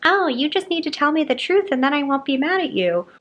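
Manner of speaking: high mocking whiny girlfriend voice; mimicking girlfriend. Friends mimicking the couple's fight, high whiny mockery. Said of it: "they're, like, mimic".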